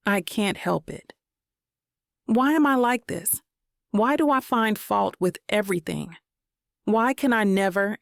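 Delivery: plain reading voice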